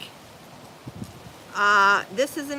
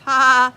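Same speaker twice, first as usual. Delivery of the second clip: loud laugh; 4 semitones higher, 13 dB louder than usual. Real, not acted. Laughing at the commenter's advice.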